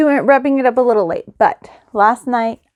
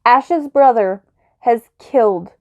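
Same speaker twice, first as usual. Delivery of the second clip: usual speaking voice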